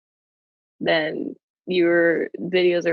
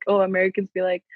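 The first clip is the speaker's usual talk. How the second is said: normal talking voice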